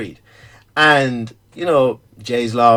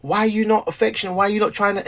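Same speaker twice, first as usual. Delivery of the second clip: hurt complaint; 7 semitones higher, 4 dB quieter, faster than usual. Retold or imitated. Imagined partner's complaint.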